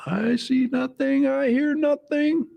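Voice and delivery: high, slow German sergeant voice; slow comic German guard; Sergeant Schultz. Retold or imitated. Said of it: "renamed to Sergeant Schultz".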